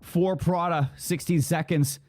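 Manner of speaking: driving esports shoutcast delivery; live play-by-play. Real, not acted. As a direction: call the clutch as it happens.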